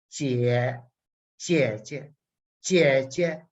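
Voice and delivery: "jie" said over and over, low at first; repeated jie. Shown as a demonstration. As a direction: drill "jie" again and again.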